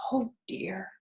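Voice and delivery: soft anxious whisper to himself; fretting under his breath; hushed little worry; worried whisper. A narrator's reading. Wilbur's worried thought.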